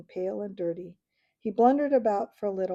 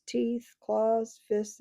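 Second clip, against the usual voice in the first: usual speaking voice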